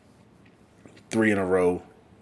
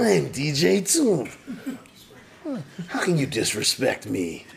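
terrible [name] impression; 7 semitones higher than usual. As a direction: ham up an insulted [name]. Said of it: "with a terrible, uh, impression".

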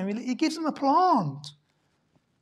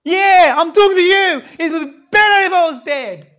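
furious shout, louder; angry shout; 7 semitones higher, 12 dB louder than usual. Acted. Preacher repeats Jonah's death wish at the listeners, shouting. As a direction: bellow it at the congregation. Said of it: "Listen to him".

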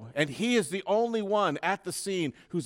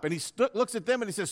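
ordinary talk

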